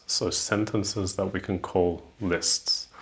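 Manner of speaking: ordinary talk, nothing marked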